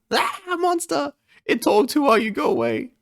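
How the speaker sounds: hammed-up fright at a talking bird; scared shriek. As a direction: yelp at a monster that talks.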